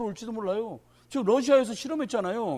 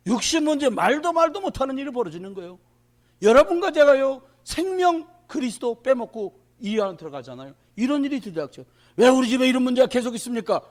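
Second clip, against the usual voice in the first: preaching at full force; 5 semitones higher, 9 dB louder than usual. Acted. Pastor warning the congregation about the times, loud and high.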